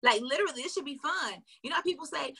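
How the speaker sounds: ordinary delivery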